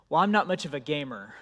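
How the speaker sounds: normal talking voice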